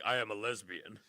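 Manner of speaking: low, soft mock man voice. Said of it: "in his man voice he was like".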